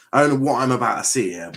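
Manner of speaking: ordinary talk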